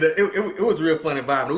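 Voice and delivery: normal talking voice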